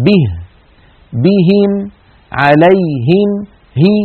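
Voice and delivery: به، بهم، عليهم with a pure "ee"; separated words, each kasra a clear close "i"; full kasra. Shown as a demonstration. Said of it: "ينخفض الفك السفلي قليلا".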